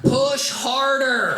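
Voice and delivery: high, long drawn-out mock yell; mom yelling back. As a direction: imitate a mother calling from another room. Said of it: "She'd yell back".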